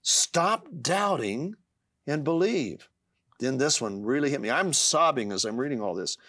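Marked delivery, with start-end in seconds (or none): 0.0-2.9 emphatic recitation, high on the key words; scripture recitation. Acted. Reading Jesus' words to himself in trouble, emphatic scripture recitation. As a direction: proclaim the promise, pitch leaping up.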